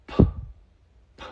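"p" as a bare puff of air; aspirated p. Shown as a demonstration. Teacher shows English stop consonants, breathy puffed "p". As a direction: pop "p" with no voice, just breath. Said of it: "son aspiradas".

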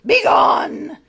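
high, very loud witch shout; witch's cry. Acted. Witch banishing the monster, shouting. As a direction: banish them with a screech. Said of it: "cried the witch".

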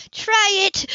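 high, loud furious challenge; enraged. A narrator's reading. Grace challenges the mother threatening to take the boy, enraged. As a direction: dare them with a burst of rage. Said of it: "Rage tore through her like a sword".

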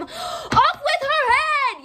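high, loud, slow furious queen; Queen of Hearts raging at the gardeners; yelling Queen of Hearts. Acted. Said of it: "yelled the Queen of Hearts".

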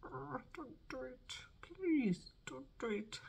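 high, slow pleading whisper. Retold or imitated. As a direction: beg in a tiny high whisper. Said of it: "I'm like".

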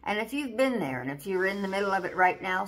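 usual speaking voice

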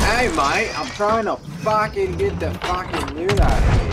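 high, loud shout at a teammate; annoyed shout. Real, not acted. Yelling at a crewmate firing cannons while looting, annoyed shout. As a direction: yell him off, you're busy looting.

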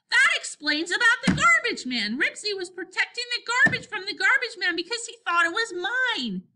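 high, loud rush of realization; excited. A narrator's reading. Henry explains the garbage man trouble to Scooter, excited. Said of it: "Henry was growing more excited".

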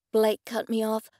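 plain reading voice

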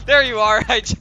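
laughing loud burst of delight; excited laughing greeting. Real, not acted. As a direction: laugh it out, thrilled to find him. Friend finally appears in chat.

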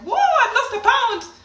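high, loud whoop of triumph; elated squeal with a laugh in it; jumping for joy. Retold or imitated. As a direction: celebrate wildly over a tiny win. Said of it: "I'm jumping like".

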